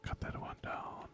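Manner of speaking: breathy unvoiced whisper. Real, not acted. Sneaking up on an enemy in the game, whispering. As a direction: hush it, sneaking past.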